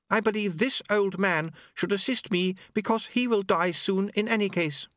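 high, precise voice laying out a plan; Unity, matter-of-fact and formal. Acted.

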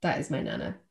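deep nana voice. Retold or imitated. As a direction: drop low like a gravel-voiced Dublin granny. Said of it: "She's got a very deep voice".

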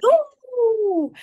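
mock-amazed ooh; impressed ooh. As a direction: coo at your own great shot. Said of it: "when you do something good".

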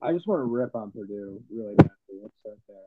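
muffled, like talking underwater; bad muffled mic sound. Real, not acted. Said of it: "Your audio sounds like you're underwater".

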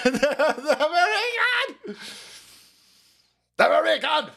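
laughing repeat. Real, not acted. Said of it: "repeats the joke laughing".

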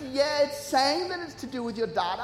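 put-on sing-song agent voice; imitated call-centre girl. Phone agent explains bill to customer, imitated sing-song. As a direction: play an unbothered phone agent. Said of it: "She goes".

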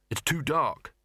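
usual speaking voice

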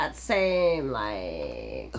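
low, creaky, trailing drawl; drawn-out vocal fry. Shown as a demonstration. Friend joining in mocking a reality star, drawn-out fry. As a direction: drag "like" down into a creak. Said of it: "who talks like that?".

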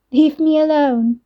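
mock her words back in a whine; mocking mimicry. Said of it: "Nicole mimicked, giggling".

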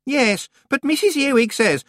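put-on high, frantic, whiny girl; anxious young witch. A narrator's reading. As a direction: rattle on in a high panicky whine. Anagramma voice.

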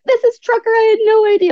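very high-pitched retold amazement; high, gushing mock surprise; astonished apology. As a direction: imitate a flustered man's sudden realisation. Stranger apologises on realising who.

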